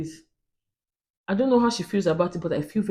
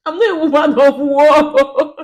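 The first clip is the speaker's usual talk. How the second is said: laughing it off, much higher and louder; 10 semitones higher, 10 dB louder, slower than usual. Real, not acted. To viewers, laughing off being a woman of war. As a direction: giggle through the denial. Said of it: "I'm a woman, woman of peace".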